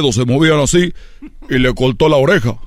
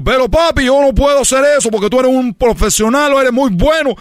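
put-on high, pleading boy voice; higher young son protesting his father; scared son; 9 semitones higher than usual. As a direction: whine it like a nervous kid.

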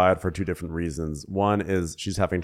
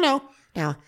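high quick impression; much faster than usual. Voicing Shannon.

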